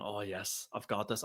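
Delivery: soft, hushed self-assured gloat; quiet gloat. Retold or imitated. One nil up at darts, hushed gloat. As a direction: whisper it to yourself with glee. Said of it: "I'm buzzing and going".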